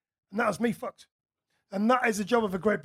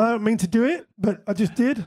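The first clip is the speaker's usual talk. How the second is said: "it" and "did" lifted at the ends; uptalk; 2 semitones higher than usual. Real, not acted. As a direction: turn the statement into a question, rising on "did". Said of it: "when you go up at the end".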